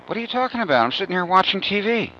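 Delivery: put-on high, soft innocent little kid. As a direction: play dumb like a caught child. Kid denying to mom.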